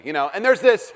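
ordinary talk

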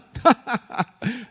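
high, hearty mock laugh; acted laugh. Abram laughing at God's promise, high mock laugh. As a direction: burst into a big mock laugh. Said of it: "because it means he laughs".

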